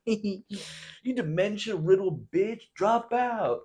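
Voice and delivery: high slow mocking squeak; high, slow cartoon heckle; mock jeering insult. Mocking jeers.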